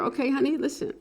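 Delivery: usual speaking voice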